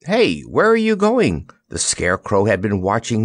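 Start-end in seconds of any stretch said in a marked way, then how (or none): 0.0-1.5 high, swooping call. A narrator's reading. Someone calls after the departing lion, calling out.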